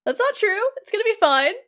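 high, bright reassurance from a tiny cricket; cheery Jiminy. Retold or imitated. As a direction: jump up in pitch to comfort him. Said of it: "He's like".

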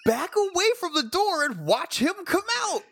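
high-pitched, incredulous complaint; exasperated. Real, not acted. Co-host scoffing at Rangers who just stand there, exasperated. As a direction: pitch it way up in disbelief.

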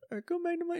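put-on high, soft timid girl. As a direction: squeak it out like a shy girl. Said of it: "she's like".

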